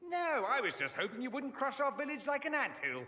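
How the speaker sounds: high-pitched, put-upon villager's reply; mock protest. Mage plays the villager answering the archdemon, mock protest. Victim's reply in imagined exchange.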